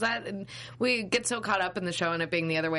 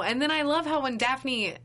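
sarcastic; 7 semitones higher than usual. Acted. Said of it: "I'm saying that in a sarcastic way".

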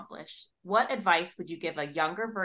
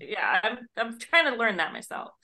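mock bewildered author; 4 semitones higher, 4 dB louder than usual. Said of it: "You're like".

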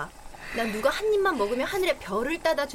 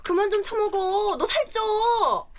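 higher, slow drawn-out scolding; nagging scold; 8 semitones higher, 4 dB louder, slower than usual. Acted. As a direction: nag her like a mother. Roommate nagging like a mother.

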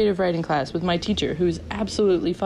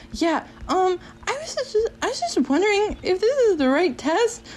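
much higher, hesitant small voice; timid asking; 11 semitones higher than usual. Retold or imitated. Student asking professor about the test, timid and high. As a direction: imitate a nervous, tentative question.

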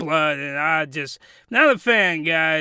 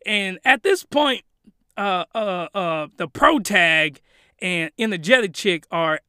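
normal talking voice

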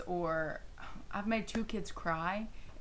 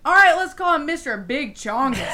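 put-on loud proud kid shout; 5 semitones higher, 10 dB louder than usual. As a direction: announce the silly name loudly. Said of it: "One of my kids ... said".

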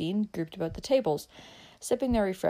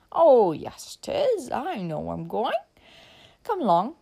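put-on drunk man; 4 semitones higher, 4 dB louder, slower than usual. A narrator's reading.

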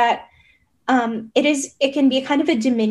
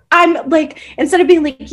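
ordinary talk, nothing marked

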